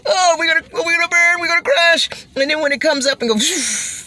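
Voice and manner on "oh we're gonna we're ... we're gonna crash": high, loud mock panic; mock terror. Imitating a panicked rider on the drop, high and loud. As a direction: wail like the car is about to crash. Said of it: "you go".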